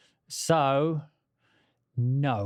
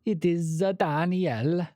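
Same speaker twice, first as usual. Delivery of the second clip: slow, higher-pitched mock Italian; 4 semitones higher, slower than usual. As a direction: draw it out in a cartoon Italian accent. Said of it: "stereotypical Italians".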